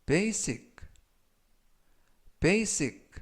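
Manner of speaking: basic said slowly and clearly for learners; model pronunciation. Shown as a demonstration. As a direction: pronounce basic as BAY-sik. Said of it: "라고 발음하시면 되겠고요".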